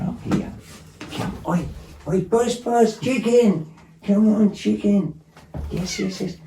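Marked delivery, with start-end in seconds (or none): 1.2-5.2 high coaxing call to the cat. Real, not acted. 5.7-6.4 soft high yes to cat. Real, not acted. Answering the cat.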